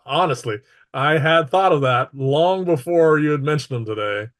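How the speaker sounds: ordinary talk, nothing marked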